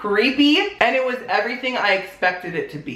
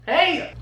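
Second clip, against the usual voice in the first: high hey and sharp gasp; high-pitched mimicked call, then a gasp; imitated movie noise; 11 semitones higher than usual. Reviewer copies what they do in the movie, imitated high gasp. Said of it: "That's what they do in the movie".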